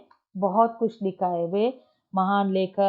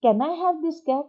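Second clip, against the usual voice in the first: put-on child asking; 8 semitones higher, much slower than usual. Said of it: "उसने क्या पूछा?".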